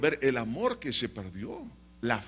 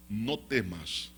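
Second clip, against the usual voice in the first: low, slow, soft comfort; calm reassurance; 7 semitones lower, 6 dB quieter, much slower than usual. Acted. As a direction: reassure softly, taking your time. Jesus reassures the bereaved father. (consuelo grave, lento y suave)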